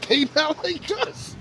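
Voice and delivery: high, rising lyric quote. Retold or imitated. Quoting a rap hook at newcomers, high and rising. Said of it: "She pulled a [name]".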